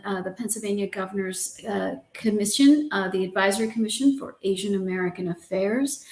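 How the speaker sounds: ordinary talk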